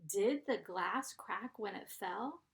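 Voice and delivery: teacher models a question to the class; question tune. Shown as a demonstration. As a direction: read it as a clear question. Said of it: "sounds more like a question".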